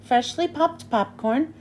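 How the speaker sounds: plain reading voice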